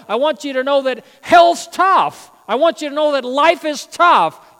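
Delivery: rising preacher's fervour, pressing each point; preaching at full force. Acted. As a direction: push it like a pulpit climax.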